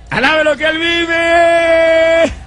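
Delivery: high, loud, slow drawn-out call; shouted praise. Acted. Preacher urges the church to praise, roaring shout. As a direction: stretch "vive" in a roaring praise. Repeated call, much stronger.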